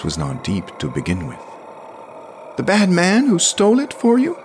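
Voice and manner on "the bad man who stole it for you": put-on high, louder boy's voice; a frightened little boy asking; anxious child. A narrator's reading. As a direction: keep the boy's high pitch. Jamie's child voice continues.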